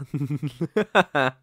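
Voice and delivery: rippling, gloating villain laugh; villainous chuckle. Acted. Captor relishes the next threat to the pet, gloating chuckle.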